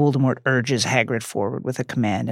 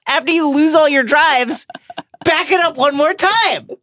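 high mock voice; 4 dB louder than usual. Mocking Voldemort's carelessness, high voice.

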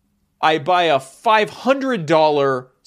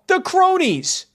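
high and drawn-out; high, slow emphasis; 4 dB louder, much slower than usual. Shown as a demonstration. Repeats answer with more force.

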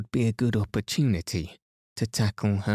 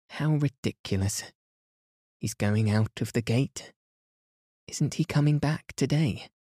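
put-on lightly raised amused young woman; a touch higher, half-laughing disbelief; Mary's voice; 4 semitones higher than usual. A narrator's reading. Mary scoffing at the narrator, lightly higher voice.

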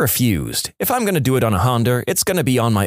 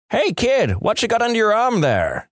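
mock yelling; 11 semitones higher than usual. Old man on porch calls to kid, mock yell. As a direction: call to a passing kid in a raised pitch. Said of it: "He yells out".

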